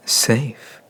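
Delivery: a short mocking laugh, then the word; amused scoff at the idea of safety. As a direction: laugh off the human's naive hope. Mocking the human's hope of safety.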